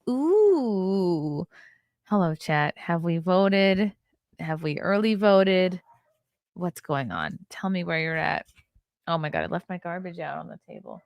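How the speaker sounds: usual speaking voice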